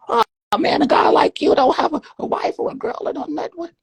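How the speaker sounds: high, loud, shocked wail; mock shock. Pimp reacting to the man with no woman, high shocked cry. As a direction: act the pimp stunned he has no girl.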